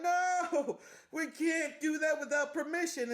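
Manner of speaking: high, whiny-style mock protest. [name] objecting to Sylvie breaking in, mock protest.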